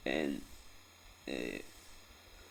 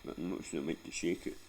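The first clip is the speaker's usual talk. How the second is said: ordinary delivery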